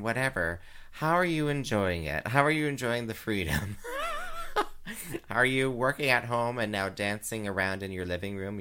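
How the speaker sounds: usual speaking voice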